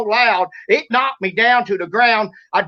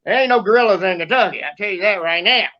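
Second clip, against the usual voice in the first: ordinary talk, nothing marked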